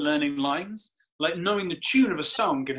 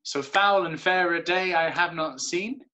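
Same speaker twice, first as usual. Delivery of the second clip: So foul and fair a day I have not seen with the stress on every second beat; iambic verse rhythm. Shown as a demonstration. Actor demonstrating the verse beat to a student, stressed. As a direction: tap out the dee-dum heartbeat under the line. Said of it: "Dee-dum, dee-dum".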